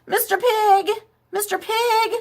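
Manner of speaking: high calling voice, pitch way up; sing-song high call. Acted. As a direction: call out to the pig through the door. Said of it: "I called".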